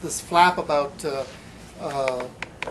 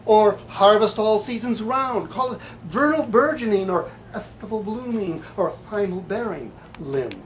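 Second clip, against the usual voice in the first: rising, emphatic poem recital, louder at the peak; poetry reading; 5 semitones higher, 6 dB louder, slower than usual. Acted. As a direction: build the lines up like an ode. Reads his takeoff poem.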